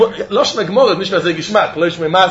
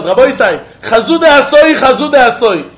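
raised, calling voice across the river; retold yell; 3 semitones higher, 3 dB louder than usual. Man stuck at the river yelling to the rabbi, raised call. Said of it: "he started to yell".

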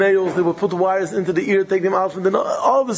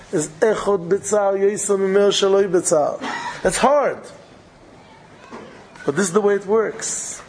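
ordinary talk, nothing marked

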